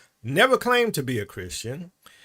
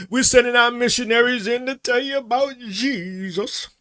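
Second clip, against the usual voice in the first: high, hammed-up announcement, JESUS stretched out; mock missionary; 7 semitones higher, 4 dB louder than usual. Colonizers sending missionaries in, mock preaching pitched high. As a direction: proclaim it in a high put-on evangelist voice. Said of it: "when they came in there the first time".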